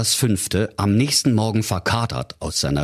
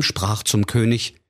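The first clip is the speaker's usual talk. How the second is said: ordinary talk, nothing marked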